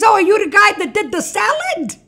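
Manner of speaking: high, loud burst of mock enthusiasm; excited recognition. Steward mistakes Caesar for the salad man, excited. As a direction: exclaim it like a clueless fan.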